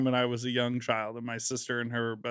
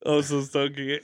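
usual speaking voice

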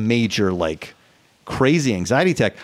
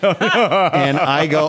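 laughing; 3 semitones higher, much slower than usual. Real, not acted.

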